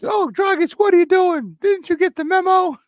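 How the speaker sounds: high falsetto taunt; mock teasing voice. Host mocking a player in high squeaky voice. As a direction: tease him in a squeaky put-on voice.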